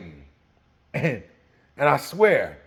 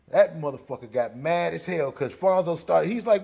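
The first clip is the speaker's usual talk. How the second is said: normal talking voice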